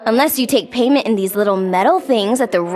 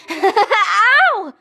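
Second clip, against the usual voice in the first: laughing ow; 7 semitones higher than usual. Acted.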